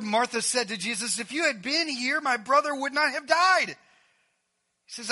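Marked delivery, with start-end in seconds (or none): 1.2-3.8 acted reproach, pitched high; reproachful. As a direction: lay the blame with a raised voice. Martha reproaching Jesus.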